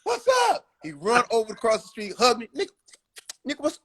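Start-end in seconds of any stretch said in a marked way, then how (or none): 0.0-0.7 high, loud street call; yelling across the street. Retold or imitated. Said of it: "He yell across the fucking street". 2.6-3.8 much higher, overjoyed greeting; excited fan. Retold or imitated.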